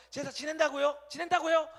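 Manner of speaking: high, loud, pushy repeat; indignant insistence. Pretend. Saul pushing God about the sacrifice, loud and high.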